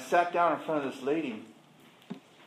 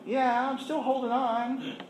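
mild mock whine; 8 semitones higher than usual. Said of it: "I said".

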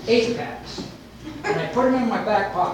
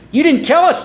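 higher-pitched mock complaint; complaining; 9 semitones higher, 4 dB louder than usual. Drinkers to bartender after cheap beer ends, complaining. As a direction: whine it as a playful accusation.

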